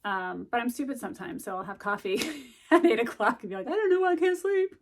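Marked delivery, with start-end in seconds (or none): 3.7-4.7 high, loud, hammed-up clueless voice; mock-innocent whine; mock bafflement. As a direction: play dumb about the coffee, laughing. Mock confusion about own coffee.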